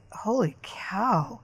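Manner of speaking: slow, hushed disbelief; stunned near-whisper. Retold or imitated. Sister reacting to dad's outburst, stunned whisper. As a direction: whisper it slowly, taken aback.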